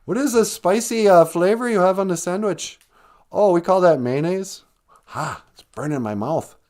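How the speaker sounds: a hint of Minnesota accent, dramatic over bland food; mock Minnesotan. Mocking a Minnesotan diner, light Minnesota accent. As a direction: ham up a Minnesotan finding mayo spicy. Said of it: "Minnesotan sits down".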